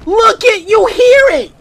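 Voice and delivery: loud and high, urgent; alarmed shout. Real, not acted. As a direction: call out in real alarm. Alarmed while chased in game.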